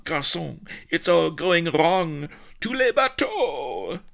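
high-pitched, hammed-up French panic; aghast Frenchman. A narrator's reading. Happy Dan losing his nerve before retreat, aghast high cries. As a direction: babble in franglais, frantic and high. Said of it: "he cried aghast".

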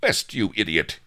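irritated captain's order; rasped. A narrator's reading. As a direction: rasp it at a bungling crewman. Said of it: "he rasped".

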